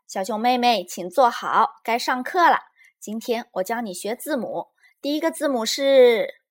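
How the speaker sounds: a touch of pretend teacher; playing teacher. A narrator's reading. Lizi plays teacher to her friend, lightly schoolteacherly. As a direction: lightly play the teacher starting class.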